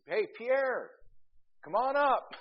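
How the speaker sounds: high, loud call up the stairs; calling him up from the bedroom. Retold or imitated. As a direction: shout for him to come up.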